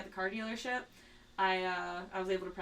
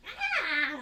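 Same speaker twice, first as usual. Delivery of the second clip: put-on high, loud little baby cry; imitated baby noise; 7 semitones higher, 5 dB louder than usual. Fan copying the actress's Baby Trunks, baby noise. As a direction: imitate a cute baby squeal. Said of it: "the cutest little baby noise".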